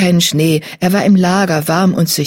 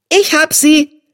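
triumphant call; 11 semitones higher, 4 dB louder, slower than usual. A narrator's reading. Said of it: "rief sein Bruder Löwenjunges".

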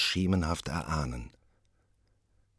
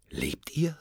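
awed whisper; 10 semitones higher than usual. Acted. Macbeth addresses eerie witches.

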